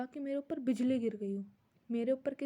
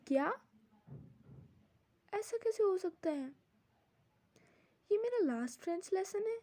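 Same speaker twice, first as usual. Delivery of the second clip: higher-pitched, stunned questioning; shocked disbelief; 5 semitones higher than usual. Acted. Pupil learns it is his last French lesson, shocked. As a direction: act out being taken aback, voice lifting. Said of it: "वो बहुत ज़्यादा चौंक गया".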